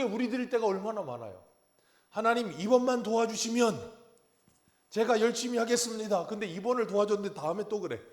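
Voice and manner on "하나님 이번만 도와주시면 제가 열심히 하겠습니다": mock pleading prayer. Believer pleading with God.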